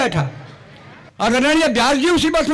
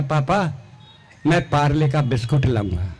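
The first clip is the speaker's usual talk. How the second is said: put-on child's voice; 10 semitones lower than usual.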